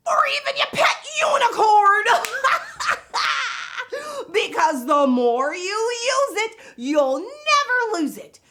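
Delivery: high, loud, fast laughing shout; Miss Vocab. Acted. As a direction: squeal it out with a giggle. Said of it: "I'm Miss Vocab".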